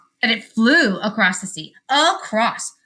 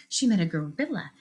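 plain reading voice

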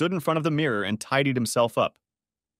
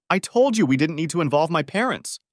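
angry; 6 semitones higher, 4 dB louder than usual. A narrator's reading. Wife furious in argument.

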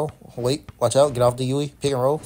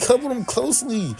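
high mock parrot of the callout; teasing repeat of the coach command; mocking game callout; 7 semitones higher than usual. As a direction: echo the callout in a higher voice. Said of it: "Fuck you mean me cover them closely?".

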